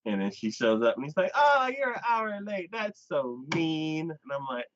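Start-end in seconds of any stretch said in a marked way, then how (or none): 1.3-4.1 high-pitched mock pout; mock hurt. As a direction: whine it as an imitated complaint, dragging the end. Voices the offended date's complaint.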